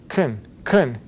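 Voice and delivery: "kun, kun" with a schwa; "kun" with the vowel cut out. Shown as a demonstration. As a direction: go straight from consonant to consonant in "kun". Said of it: "is softer".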